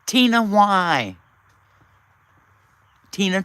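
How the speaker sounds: normal talking voice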